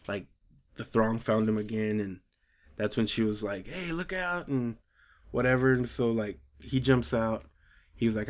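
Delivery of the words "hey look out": high, soft warning; mock warning. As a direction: warn him in a higher voice. Voicing her warning.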